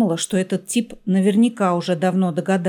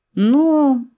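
drawn-out, high, louder opening word; pursed-lip hesitation; 7 semitones higher, 6 dB louder than usual. A narrator's reading. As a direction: stall through pursed lips. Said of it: "поджала губы Ольга".

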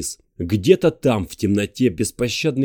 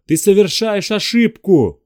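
slow loud high warning shout; 10 semitones higher, 6 dB louder than usual. Acted. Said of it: "крикнул он".

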